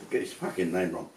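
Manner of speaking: ordinary talk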